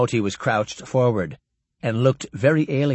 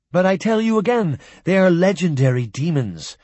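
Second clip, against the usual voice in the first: higher, louder protest; 6 semitones higher, 4 dB louder than usual. A narrator's reading. Jock protesting again to disbelieving humans, higher and louder. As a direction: push back harder, almost pleading. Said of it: "Jock protested".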